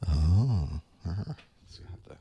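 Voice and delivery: usual speaking voice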